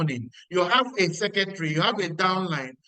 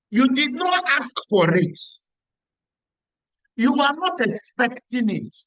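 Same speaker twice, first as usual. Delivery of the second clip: raised scolding voice; 4 semitones higher, 6 dB louder than usual. Real, not acted. Scolding the ungrateful friend.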